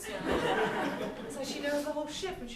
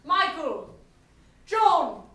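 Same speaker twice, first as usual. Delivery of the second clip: calling out; 4 semitones higher, 9 dB louder, slower than usual. Acted. Captain calling crew by name.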